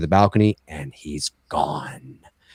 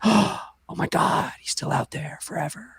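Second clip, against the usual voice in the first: eerie whisper; 10 semitones higher, 6 dB quieter than usual. Pretend. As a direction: whisper the ending like a chill. Spooky ending line, gasp.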